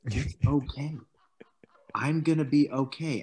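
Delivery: lightly imitating a calm coach; a hint of soothing calm; mock calm. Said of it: "It was just like".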